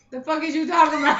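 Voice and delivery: high, laughing, can't believe it; incredulous laugh through the words; laughing disbelief. Retold or imitated. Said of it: "He was like".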